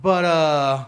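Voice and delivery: lightly voiced cocky doctor, pausing before the punchline; a hint of a God-complex surgeon taking credit; casual, self-satisfied bedside boast; smug doctor. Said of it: "And this is how he does it".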